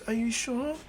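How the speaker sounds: put-on high tearful little girl; Eri voiced. Eri to Izuku, high timid child voice. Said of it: "she goes".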